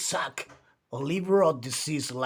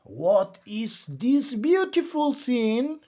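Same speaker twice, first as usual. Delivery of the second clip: curious creature voice, higher; creature wondering; 7 semitones higher than usual. A narrator's reading. The creature marvels at a found book. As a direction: wonder aloud as the sea creature. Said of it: "the creature wondered".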